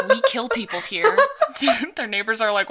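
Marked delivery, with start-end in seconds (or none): 0.1-1.2 mock menace. Pine Barrens locals scaring outsiders off.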